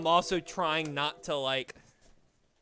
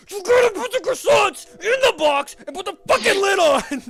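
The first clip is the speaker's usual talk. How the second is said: much higher, louder frustrated yell; fed-up shout; 15 dB louder than usual. Retold or imitated. To the new lady stacking croissants without lids, exasperated shout. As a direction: blow up at them over the lids. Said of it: "That's, like, what I wanna say".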